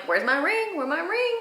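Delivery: mock whiny demand for a ring; joking nag. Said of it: "Like, just joking around".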